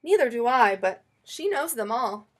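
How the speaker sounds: ordinary delivery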